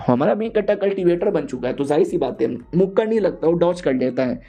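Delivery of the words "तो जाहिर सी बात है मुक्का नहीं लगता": plain reading voice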